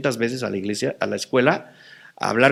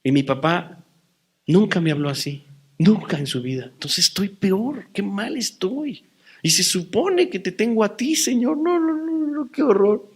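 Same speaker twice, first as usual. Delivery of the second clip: anguished prayer; 6 semitones higher than usual. Retold or imitated. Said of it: "Y dije".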